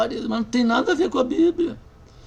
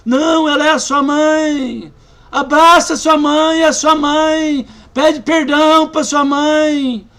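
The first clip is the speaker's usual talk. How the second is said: insistent loud urging; 6 semitones higher, 7 dB louder, slower than usual. Retold or imitated. Pastor pressing her to forgive.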